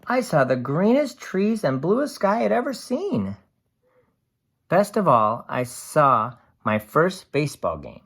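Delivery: lively storybook voice for a can's diary; cheerful picture book narration; reading to children. A narrator's reading. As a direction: read it bright and animated to a class. Reads picture book aloud.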